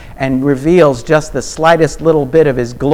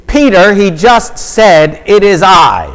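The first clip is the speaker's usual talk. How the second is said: much higher and louder, slow and insistent; exasperated preaching; 8 semitones higher, 6 dB louder than usual. Real, not acted. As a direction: raise the voice in disbelief at Peter. Preacher exasperated at Peter's doubt.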